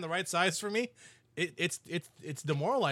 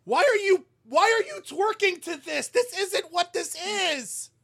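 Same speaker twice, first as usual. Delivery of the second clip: high, loud exasperated yell; mock outrage; 9 dB louder, slower than usual. Host scolding listeners who twerk to [name], mock outrage. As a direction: shout it in hammed-up disbelief.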